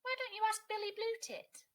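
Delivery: high, loud chirpy woodpecker. A narrator's reading. Woodpecker answering Kia, high and loud.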